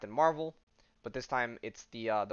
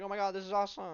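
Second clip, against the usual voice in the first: much higher, gushing fan squeal; excited fans; 9 semitones higher, much faster than usual. Retold or imitated. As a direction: cheer like starstruck onlookers. Crowd reacting to Ms. Marvel.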